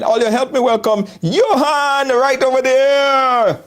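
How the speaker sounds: slow, drawn-out showman welcome; host introduction. Acted. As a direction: announce him like a host, slowly. Host welcomes guest on show.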